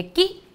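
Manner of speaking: कि as one quick high syllable; short light vowel. Shown as a demonstration. Teacher shows the matra's small sound, कि cut short. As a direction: clip the i in कि very short. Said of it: "थोड़ी सी आवाज़ होती है".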